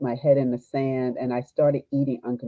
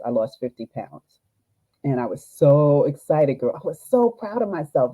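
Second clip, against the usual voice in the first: ordinary delivery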